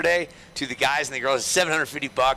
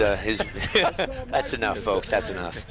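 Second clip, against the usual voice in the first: laughing; 6 semitones lower than usual. Real, not acted. Cracking up at own joke.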